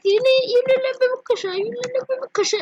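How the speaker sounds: high, quick cooing at a pet; baby-talk teasing. Retold or imitated. Owner teasing her cat, baby-talk.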